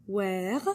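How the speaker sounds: "Where," with a French R. Shown as a demonstration. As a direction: say "Where," alone, French R at the end. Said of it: "notice that R".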